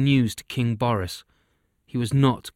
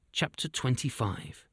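usual speaking voice

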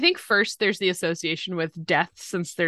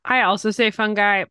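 ordinary talk, nothing marked

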